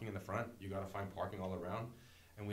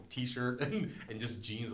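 normal talking voice

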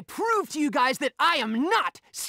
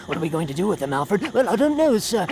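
usual speaking voice

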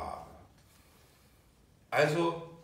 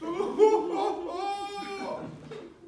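high laugh; 7 dB louder than usual. Real, not acted. Laughs within child voice.

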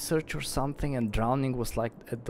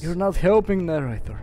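loud, raised complaint; annoyed, raised-voice protest; exasperated scolding; 5 semitones higher, 6 dB louder than usual. Real, not acted. Scolding the game's narrator.

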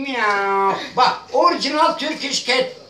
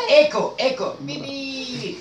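usual speaking voice

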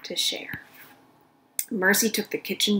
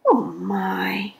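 dismayed whisper. Acted. Baby dismayed after sister leaves.

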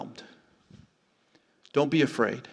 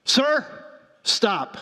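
sharp high call, raised voice; a retold shout, pitched way up to halt the man; 9 semitones higher than usual. Officer stopping the drunk giant, shouted. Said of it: "And he shouted out".

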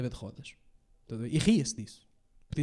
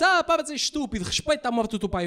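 higher mock scolding; scolding mum; 7 semitones higher than usual. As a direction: tell him off like mum. Mother scolding the son.

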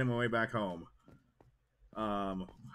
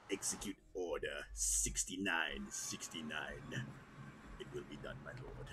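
lightly voiced dark lord; 9 semitones lower, 11 dB quieter than usual. Gamer joking with the Order line, a light villain voice. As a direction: toy with the villain voice.

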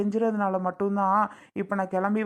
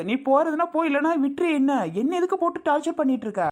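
angry shout; 6 semitones higher than usual. Acted. Husband yelling at his pleading wife, angry. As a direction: snap back at her, fed up.

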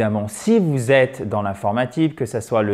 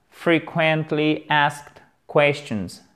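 very slow, each word set apart; slow pronunciation model; much slower than usual. Shown as a demonstration. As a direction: articulate every syllable of Frequently asked questions. Teacher repeats phrase slowly for learners.

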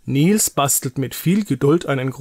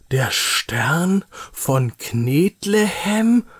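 slow, higher, rising in disbelief; incredulous question; 4 semitones higher, much slower than usual. Acted. Nils questioning mother about the clay lump, incredulous. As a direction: question it word by word, unbelieving. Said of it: "fragt Nils ungläubig".

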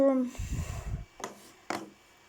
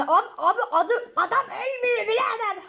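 laughing; 6 semitones higher than usual. Real, not acted. Enemy refuses to die.

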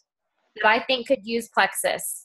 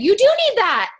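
eager insistence; 11 semitones higher, 4 dB louder than usual. Retold or imitated. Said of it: "And I said".

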